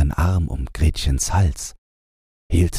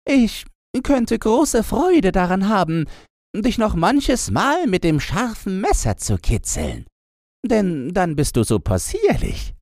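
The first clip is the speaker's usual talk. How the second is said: higher, playful menace; teasing robber girl. A narrator's reading. Robber girl teases the reindeer, high playful voice.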